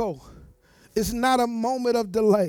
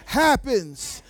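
call and response; 4 dB louder than usual. Acted. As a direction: lead the crowd line by line. Leading loud call and response.

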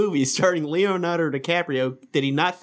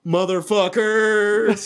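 slow loud drawn-out yell; shouted greeting; 6 semitones higher, 4 dB louder, much slower than usual. Retold or imitated. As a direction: bellow it slowly at the crowd. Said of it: "And then you're like".

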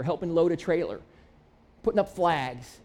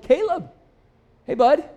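calling out; 9 semitones higher, 8 dB louder than usual. Retold or imitated. Greeting the old kid warmly.

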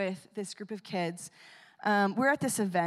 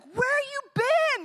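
put-on much higher, slow, eager child voice; little boy voice; 7 dB louder, slower than usual. The boy greets the tattooed man in a high eager child voice. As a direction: play the excited kid who finally found him. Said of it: "he's like".

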